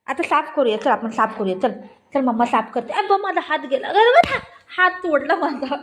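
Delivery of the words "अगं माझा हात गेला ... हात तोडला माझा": high, loud yelp of complaint; mock-pained outcry. Mother protesting that her hand is broken, high outcry. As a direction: cry out high and loud, half laughing. Crying out over a hurt hand.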